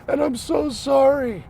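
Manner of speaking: hammed-up, high little tearful voice; imitating tearful apology. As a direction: act out a daughter's upset apology. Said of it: "she burst into tears".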